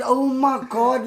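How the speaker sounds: slow, high, loud oh my God; stunned. Retold or imitated. To the radio host on the phone, a slow stunned exclamation. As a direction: draw it out in disbelief. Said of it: "I got a shock".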